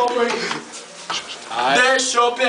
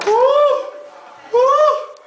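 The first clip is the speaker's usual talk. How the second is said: sharp high yelps; 11 semitones higher than usual. Real, not acted. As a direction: yelp at the cold water. Cold shower shock. (gritinhos agudos)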